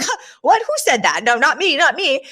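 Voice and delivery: high, startled mock denial; hammed-up flustered squeak. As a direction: play the caught-out boss. Retelling flustered sitcom boss.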